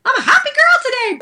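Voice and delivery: wife telling husband her joy, high and bright; happy. Retold or imitated. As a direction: chirp it like a giddy teenager. Retold joy after breakthrough.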